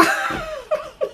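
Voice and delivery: short high burst of laughter. Real, not acted. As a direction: laugh out at your own joke. Laughs at own joke.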